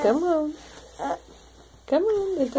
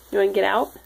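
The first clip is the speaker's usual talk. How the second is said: normal talking voice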